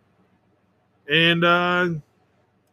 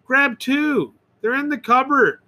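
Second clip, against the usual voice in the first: put-on child in ad; 7 semitones higher than usual. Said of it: "the child says".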